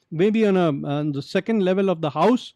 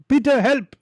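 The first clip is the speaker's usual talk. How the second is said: high, mildly raised call; calling for help; 7 semitones higher, 4 dB louder than usual. Acted. Jesus calling on Peter for help, calling out. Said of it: "If Jesus were to call".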